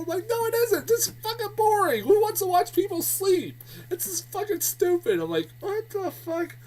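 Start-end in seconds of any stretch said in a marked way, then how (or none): 0.3-5.2 high-pitched, indignant rant; exasperated retort. Acted. Reviewer arguing with Joaquin Phoenix's character about the documentary, exasperated. 5.6-6.6 high-pitched, incredulous reaction; baffled disbelief. Acted. Reviewer reacting to the documentary of a sleeping mother, baffled.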